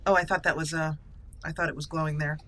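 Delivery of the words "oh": ordinary talk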